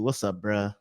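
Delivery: ordinary talk, nothing marked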